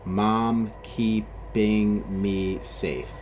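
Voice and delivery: "Mom keeping me safe" loud and drawn out, beat by beat; syllable by syllable. Shown as a demonstration. Teacher checking the haiku's last line, "Mom keeping me safe" syllable by syllable. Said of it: "Let's go over this last one".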